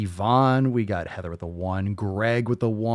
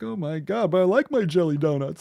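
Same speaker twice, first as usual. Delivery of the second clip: mock offended whiner; 8 semitones higher than usual. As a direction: wail it like an exaggerated offended complainer. Mimics offended recipient of podcast.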